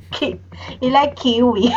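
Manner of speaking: laughing through the words; amused at the kiwi soap. Real, not acted.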